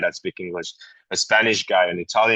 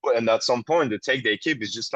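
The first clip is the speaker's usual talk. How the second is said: usual speaking voice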